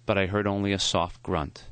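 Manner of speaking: ordinary delivery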